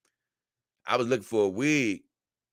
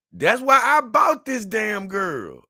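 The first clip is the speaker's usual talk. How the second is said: high, loud, drawn-out outburst; emphatic exclamation; 11 semitones higher, 5 dB louder, slower than usual. Real, not acted. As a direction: belt it out slowly, pitched way up. Exclaiming over buying his doll.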